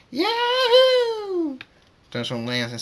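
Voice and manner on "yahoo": very high, loud whoop; long squealing "yahoo" at full voice; screamed yahoo. A narrator's reading. Scootaloo enjoys the flight, screaming whoop. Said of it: "Scootaloo screams".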